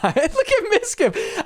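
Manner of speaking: high, drawn-out words through real laughter; laughing. Real, not acted. Streamer showing viewers Mizkif on screen, laughing. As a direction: crack up while pointing him out.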